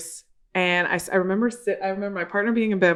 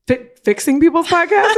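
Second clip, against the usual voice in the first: incredulous; 10 semitones higher, 8 dB louder than usual. Retold or imitated. Retold incredulous reply.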